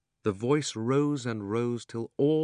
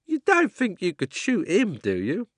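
higher, louder doubting cabman; a working-class cabby, incredulous; cockney cabby; 11 semitones higher, 9 dB louder than usual. Acted. The cabby questions.

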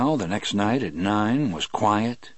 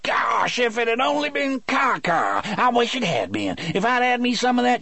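very high, shouted cackling voice; howling with glee. Acted. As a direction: howl it out, delighted. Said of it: "held his belly and howled".

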